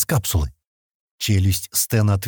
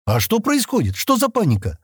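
higher-pitched, puzzled questioning; bewildered question; 10 semitones higher than usual. A narrator's reading. Kenni asking Sten what the panic is, bewildered. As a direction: ask in bewilderment, pitch jumping up. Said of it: "недоумённо спросила Кенни".

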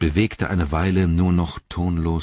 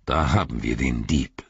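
tiefe, grimmige Zaubererstimme; low, grim old wizard; Gandalf; 4 semitones lower than usual. A narrator's reading. (finsterer Zauberer, tief)

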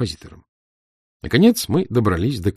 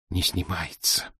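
softer, slow, barely heard; barely audible; 2 semitones lower, 8 dB quieter, slower than usual. A narrator's reading. Anton answering the angry mother, barely audible. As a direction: say it almost inaudibly, afraid. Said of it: "чуть слышно сказал Антон".

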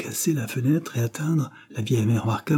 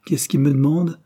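louder, higher call as he goes out; 6 semitones higher, 5 dB louder than usual. A narrator's reading. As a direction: shout the question on the way out. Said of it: "cria-t-il en sortant". (Cyril crie en sortant, plus fort et plus aigu)

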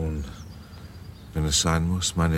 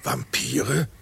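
shocked question; 9 semitones higher than usual. Acted. General shocked at the word.

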